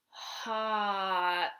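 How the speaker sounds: stretched out. Shown as a demonstration. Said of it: "Now stretch it out".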